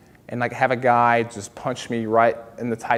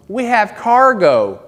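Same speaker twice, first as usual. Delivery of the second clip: audience lady; 7 semitones higher, 5 dB louder, slower than usual. Retold or imitated. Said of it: "this lady in the back goes".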